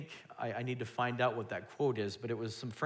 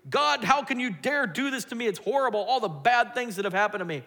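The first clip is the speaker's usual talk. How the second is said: much higher, louder complaint at God; bitter outcry; 9 semitones higher, 6 dB louder than usual. Retold or imitated. Preacher on his worst days accusing God, loud outcry. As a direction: hurl the grievance upward. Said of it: "like me on some of my worst days".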